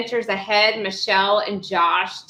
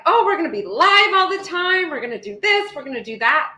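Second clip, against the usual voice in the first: higher, eager burst of plans; mock-excited vacation planner; 7 semitones higher, 3 dB louder than usual. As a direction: gush about being live all the time. Retelling her own excited plans.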